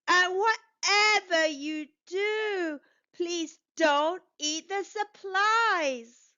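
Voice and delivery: pleading warning. Acted. Bucky begs the hungry workers, slow pleading warning. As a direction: coax them slowly not to touch it.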